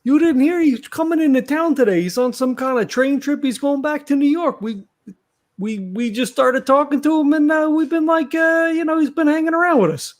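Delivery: high-pitched, chatty explanation; Vinny in character. Acted. Vinny tells the doorman about the Babe's visit, high chatty voice.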